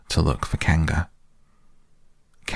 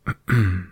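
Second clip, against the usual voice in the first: small throat-clearing cough; polite ahem; little cough; 2 semitones higher than usual. A narrator's reading. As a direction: clear the throat before a recital. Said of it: "Pooh gave a little cough".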